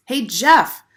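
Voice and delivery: loud call across the street, pitched high; shout. A narrator's reading. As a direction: yell his name to get his attention. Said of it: "Laurie shouted".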